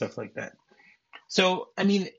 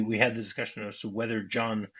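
usual speaking voice

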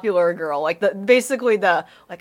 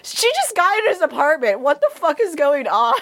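usual speaking voice

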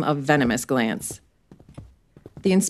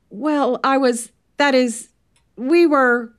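higher, tripping over his words; flustered; 8 semitones higher, 4 dB louder, slower than usual. Acted. Merton asked what he did with Janet, flustered. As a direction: flounder for an answer.

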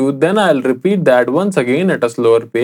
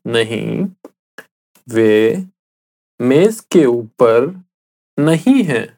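slow, with pauses between the words; slowly, word by word. Shown as a demonstration. Teacher repeating the answer for the listener, slow and word by word. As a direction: lay each word out for a learner to copy. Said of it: "I'll say it again slowly".

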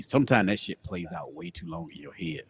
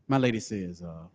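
ordinary talk

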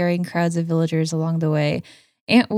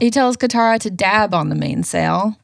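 ordinary talk, nothing marked

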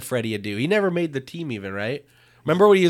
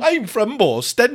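high, slow hammed-up Boston accent; mock Boston accent; 11 semitones higher, 4 dB louder than usual. Friend copying a Boston accent, high and slow. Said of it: "That's maybe my favorite accent".

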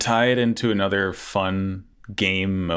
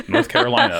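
mock American; 4 dB louder, faster than usual. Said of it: "when everyone talks like British".

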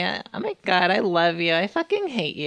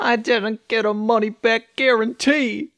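lightly voiced cartoon king lamenting; mock bereft complaint, a touch louder; King Dedede; 3 semitones higher, 4 dB louder than usual. Joins in voicing the grieving king.

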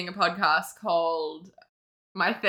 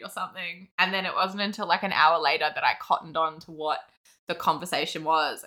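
normal talking voice

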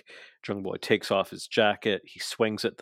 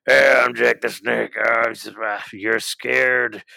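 Jake the Snake impression; 6 dB louder than usual. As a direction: growl it out like an old wrestler on the mic. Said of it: "Jake the Snake grabs the mic and just".

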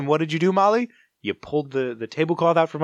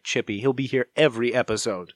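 normal talking voice

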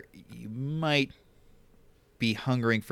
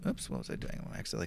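unvoiced mutter to yourself; whispered aside; 6 semitones lower, 12 dB quieter, much faster than usual. Real, not acted. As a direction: whisper it under your breath. Muttering to self at computer.

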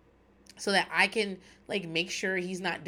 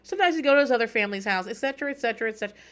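higher, louder, fast run of places; eager listing; 5 semitones higher, 6 dB louder, faster than usual. Retold or imitated. R J listing where the dog slept at homegoing, eager and fast. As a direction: rattle off every spot like an eager kid. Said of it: "R J's like".

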